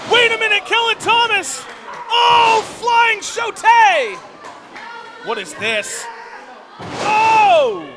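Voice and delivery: high, loud frantic play-by-play shouts; wrestling commentator. Real, not acted. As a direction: scream the big spot live. Wrestling call at a big moment.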